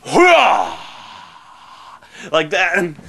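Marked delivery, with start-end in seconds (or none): 0.1-0.8 louder hammed-up battle cry; mock heroic cry. Mocking Wolverine's heroic pose, hammed-up cry. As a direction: bark it like a posing hero. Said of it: "he's like".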